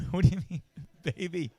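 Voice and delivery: half-laughing, disbelieving question; laughing disbelief. Retold or imitated. Husband laughing in disbelief at his wife's baby talk.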